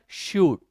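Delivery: शूट with a drawn-out oo; long vowel. Shown as a demonstration. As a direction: stretch the oo in शूट. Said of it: "गया लंबा".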